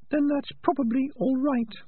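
very high, thin rat voice; small, high-pitched rat; reassuring little rat. Acted. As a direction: reassure him in a tiny squeaky voice. Little rat's put-on voice.

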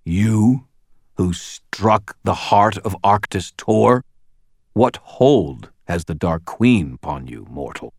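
loud, weighty disbelief in a creature's voice; incredulous gruff. A narrator's reading. Gruff questioning the one who struck Arctis Tor, incredulous. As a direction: demand it grandly, word by word, astonished.

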